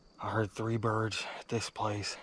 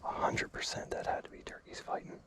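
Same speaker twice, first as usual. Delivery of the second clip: hushed hunter's whisper; 8 dB quieter than usual. Real, not acted. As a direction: whisper so the birds don't hear. Hunting whisper.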